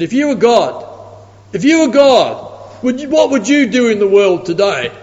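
lightly voiced doubting man; challenging atheist. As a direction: press the question like a sceptic.

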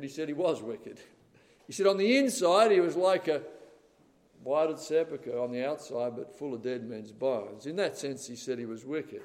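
normal talking voice

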